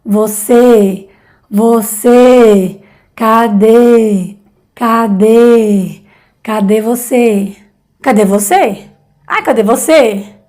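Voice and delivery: você and cadê vibrating in the chest; closed vowel demo. Shown as a demonstration. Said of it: "pon tu mano en tu pecho". (vocales cerradas con vibración en el pecho)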